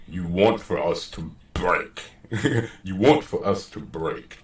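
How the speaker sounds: deep, soft dramatic reading; added bass. Shown as a demonstration. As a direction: drop into a low bass to read it, slightly amused. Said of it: "sprinkle a little bass on it".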